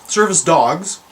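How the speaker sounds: normal talking voice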